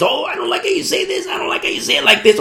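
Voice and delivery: high, soft whining objection; mock complaint. Listeners complain to the preacher about his wording, mock complaint. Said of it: "I get the complaints".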